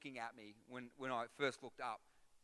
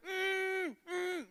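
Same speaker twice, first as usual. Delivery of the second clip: high, loud shouted mm through closed lips; desperate muffled shouts to warn a diver of a shark; really loud muffled hum; 10 dB louder than usual. Retold or imitated. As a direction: blast two frantic closed-mouth calls. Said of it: "I'm being really loud".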